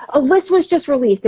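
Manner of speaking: high-pitched excited announcement; retold emotional high. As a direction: pass on big news to friends, excitedly. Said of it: "it would be like this high".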